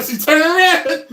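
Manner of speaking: high, loud laughter; helpless high-pitched laughter; laughing hard. Real, not acted. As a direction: break up laughing. Cracking up at his story.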